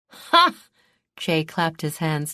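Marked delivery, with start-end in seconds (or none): 0.1-0.5 a loud high triumphant bark of a laugh; gleeful ha. A narrator's reading. Che crows with delight at the news, loud triumphant ha. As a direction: crow with delight.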